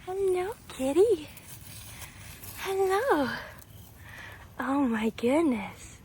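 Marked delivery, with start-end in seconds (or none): 0.0-3.3 cat talk. Real, not acted. Greeting a cat.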